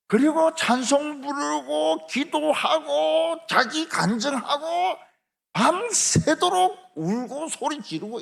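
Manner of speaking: high, drawn-out preaching cry, each phrase stretched; full-force preaching. Real, not acted. Preacher telling of blind people praying all night, full-force preaching.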